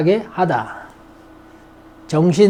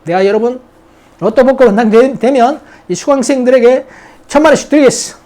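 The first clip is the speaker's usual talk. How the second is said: louder, higher bragging promise; boastful big talk; 5 semitones higher, 5 dB louder, faster than usual. Pretend. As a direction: brag loudly, sure it will never happen. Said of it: "큰 소리 치죠".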